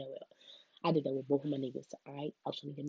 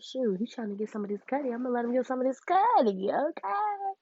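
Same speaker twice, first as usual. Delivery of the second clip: higher, teasing flirty voice that climbs at the end; playful mock flirt; 8 semitones higher, 3 dB louder than usual. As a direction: tease it like you're about to give in. Acting out her flirty inner thought.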